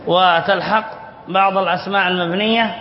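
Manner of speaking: ordinary delivery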